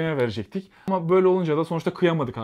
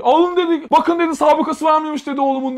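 high, loud outraged bellow; furious father; 11 semitones higher, 5 dB louder than usual. Retold or imitated. Father storming into the station to defend his son, furious shout. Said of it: "delire delire ... hışımla".

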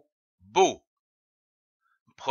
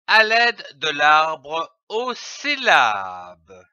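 ordinary delivery

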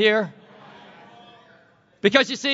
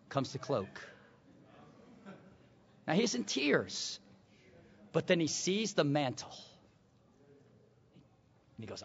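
much softer and lower, almost whispered; hushed storytelling; 9 semitones lower, 11 dB quieter than usual. Acted. Preacher hushing the church as the mantle falls, whispered. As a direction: draw them in, barely above a breath.

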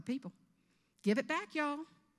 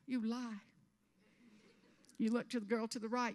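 usual speaking voice

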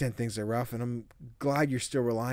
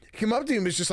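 excited; 10 semitones higher, 5 dB louder, much faster than usual. Real, not acted. Streamer exclaims at the game.

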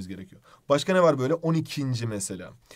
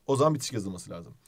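ordinary delivery

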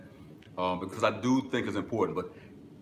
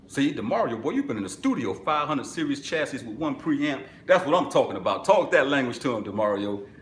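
excited; 5 semitones higher, 5 dB louder than usual. Real, not acted. Hyping up a viewer.

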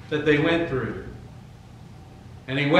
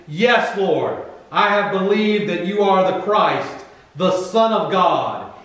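raised, firm confession of faith; emphatic declaration; 5 semitones higher, 7 dB louder, slower than usual. Acted. Preacher voicing Martha affirming Jesus's divinity, emphatic declaration. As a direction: declare it with conviction.

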